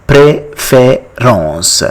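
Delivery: préférence drawn out slowly, nasal French ending; slow French pronunciation. Shown as a demonstration. Teacher slowing the French word for learners, slow and careful. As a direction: spell out préférence slowly, each syllable clear. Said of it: "in French it is called".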